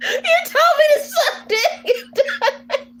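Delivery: very high, laughing squeal; helpless laughter. Real, not acted. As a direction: repeat it while cracking up. Cracks up repeating the insult.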